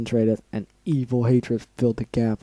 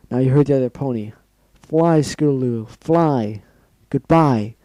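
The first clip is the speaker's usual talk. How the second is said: urgent farewell; 2 semitones higher, 8 dB louder than usual. Acted. Dying pony urging friend to flee.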